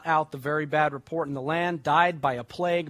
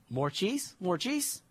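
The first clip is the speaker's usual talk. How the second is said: put-on toddler voice; 3 semitones higher, 5 dB quieter, slower than usual. Daughter happily asking again.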